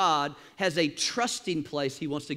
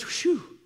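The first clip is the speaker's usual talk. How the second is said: relieved sigh; 12 semitones higher than usual. Retold or imitated. Abraham relieved at last moment.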